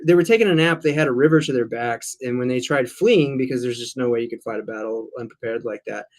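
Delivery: ordinary delivery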